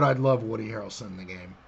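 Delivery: ordinary delivery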